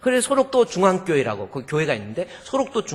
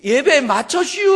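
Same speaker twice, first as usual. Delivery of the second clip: high, loud drawn-out Chungcheong plea; acted-out country protest; imitated dialect call; 9 semitones higher, 8 dB louder than usual. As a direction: call out with a rising, stretched ending. Weeping man's dialect complaint, acted.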